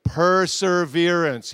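preacher's call to repeat; loud slow call-out. Shown as a demonstration. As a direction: stretch it out so they echo it. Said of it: "Everybody say it".

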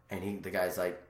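usual speaking voice

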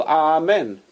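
"Amen" with the "A" held long, "aah-men"; long first syllable. Shown as a demonstration. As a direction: stretch the opening "ah" of "Amen". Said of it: "it's a long syllable".

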